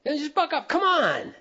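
high, pushy mock impatience; impatient husband. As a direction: brush off her feelings.

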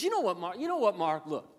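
high, loud, exasperated telling-off; hammed-up scolding, punching the key words; mock scolding God. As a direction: play an exasperated God dressing down a pastor. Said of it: "he looks at you and goes".

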